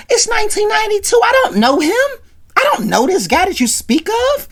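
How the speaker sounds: high, fast mock disbelief, a shade off his own voice; incredulous high. Real, not acted. Host mocking [name]'s fame to his listeners, in a high, baffled voice. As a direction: act baffled you've never heard of him.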